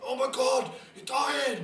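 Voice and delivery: high, loud frantic cry; mock panicked friends. Teenage friends panicking over a sick mate, high frantic cry. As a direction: shriek it like they think he's dying. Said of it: "it's all like".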